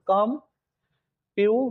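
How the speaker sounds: Computer slowly broken as कम, प्यू, टर; long pauses inside computer; split into syllables. Shown as a demonstration. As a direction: say computer in three separate beats. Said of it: "computer word में विद्यार्थी कितने syllable".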